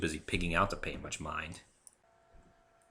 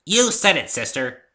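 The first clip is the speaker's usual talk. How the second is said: much higher and louder, bursting in; excited chime-in; 9 semitones higher, 12 dB louder, slower than usual. Acted. Pinky cheering Applejack on with excitement. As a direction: jump in with eager glee. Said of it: "Pinky interjected".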